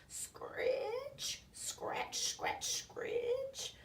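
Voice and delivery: high whispered scratching sounds; hoe noise. A narrator's reading. Unseen gardener hoeing nearby, whispered scratch. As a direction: whisper it like a hoe scraping earth.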